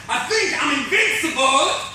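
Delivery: high, loud, triumphant shout; manic boast. Acted. Rising rock star boasts on no sleep, manic shout. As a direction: shout it like you're unstoppable.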